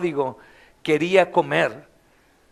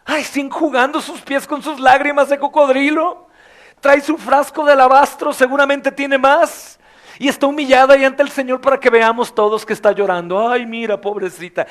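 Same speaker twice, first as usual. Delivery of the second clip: higher, louder scornful gossip; louder mock of scornful churchgoers; mocking critics; 7 semitones higher, 5 dB louder than usual. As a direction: sneer at her as a self-righteous critic. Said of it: "algunos están diciendo".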